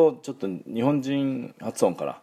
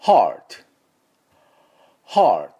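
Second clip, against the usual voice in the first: American "heart" with R, louder model take; American pronunciation; 6 dB louder than usual. Shown as a demonstration. Teacher leading repeat-after-me drill, American pronunciation. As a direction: curl the R clearly, American style, for repeating. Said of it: "じゃあ、ついでにイギリスの音でも".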